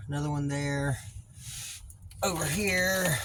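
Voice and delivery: talking while lying under a truck; lying under a car. Real, not acted. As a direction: speak flat on your back under a car. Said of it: "I'm under the truck".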